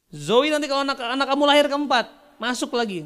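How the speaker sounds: high, loud exclamation; excited announcement. Retold or imitated. Principal offering free entry for the unborn fourth child, excited. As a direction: belt it out, easing off at the end.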